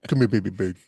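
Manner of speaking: lightly voiced drunk Brando mumble; a hint of a slurring Brando; Jon Voight doing Brando. Podcast host mocking the actor's odd snake-man voice, mild drunk Brando impression. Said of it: "trying to do a Marlo, uh, Brando impersonation".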